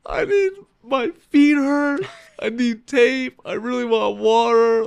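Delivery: high, slow, tearful whimper; imitating his own sobbing on the phone; retold crying plea. To Tyler on the phone, high tearful whimper. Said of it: "I just was crying".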